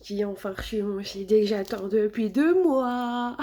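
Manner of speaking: drawn-out, theatrical excitement; slow gleeful wail on the last words; gleeful announcement. Real, not acted. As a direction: stretch it out like long-awaited big news. Celebrating a long-awaited CD arrival.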